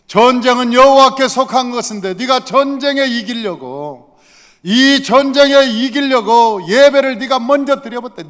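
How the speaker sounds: loud, high outraged accusation; indignant preaching. Acted. Samuel faulting one who worshipped first to win, indignant.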